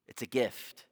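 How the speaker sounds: normal talking voice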